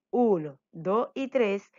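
"uno, dos y tres" counted out slowly, one beat each; slow counting. Shown as a demonstration. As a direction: count the syllables slowly for the class. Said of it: "y si la enumeramos".

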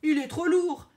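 high, loud objection; sharp rejoinder. A narrator's reading. Crocodile objecting to the rhino's idea, sharp rejoinder. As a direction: shoot the idea down. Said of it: "réplique Croco".

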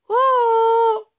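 long high "oh" cried down a well; loud shout. Acted. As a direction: shout a ringing, sustained oh. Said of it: "gridò". (grido acuto e prolungato)

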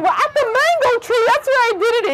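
very high little rabbit. A narrator's reading. Rabbit telling lion about the mango tree, high voice. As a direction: pipe it up high.

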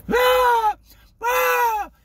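loud high-pitched comic shriek; mock scream. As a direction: let out two hammed-up yells. Comic cry about interrupted life.

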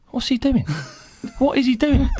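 high, rising laughing disbelief; can't believe what he's seeing; baffled disbelief. Retold or imitated. Watching his teammate slide across the dance floor in disbelief.

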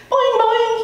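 high springy repeated boings; frog's boing. Acted. Frog answers the hamster with high springy boings. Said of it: "he said".

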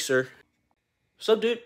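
usual speaking voice

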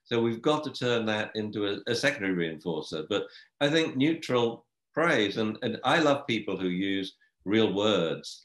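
ordinary talk

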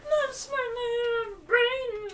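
very high, hammed-up dopey whine; mock caveman voice; dumb voice. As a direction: play the dim-witted guy. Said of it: "I ain't smart".

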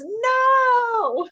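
loud, high, drawn-out no; defiant refusal. Acted. Green hat snowman refusing to give up his hat, defiant cry.

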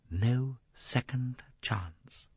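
slow, soft repetition; brooding echo. A narrator's reading. Jacqueline echoing Poirot's warning, slow and brooding. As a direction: draw out each word, softly dwelling on it.

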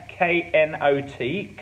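K N O T spelled slowly, letter by letter; spelling out the letters of knot. Shown as a demonstration. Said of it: "That's how it's spelled".

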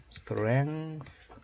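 "strength" drawn out, ending in TH. Shown as a demonstration. Teacher pointing out the TH, slow model of "strength". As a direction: stretch "strength" and finish on a TH sound. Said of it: "이것도 티에이치 발음 있죠?".

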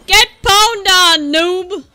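slow, loud gloating jeer; toxic taunt. Real, not acted. Gamer trash-talking a noob, toxic taunt. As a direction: drag out the taunt loudly. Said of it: "That was toxic".

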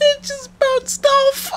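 slow, loud, teary laughter through words; laughing to tears; crying with laughter. Real, not acted. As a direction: gasp it out while laughing helplessly.

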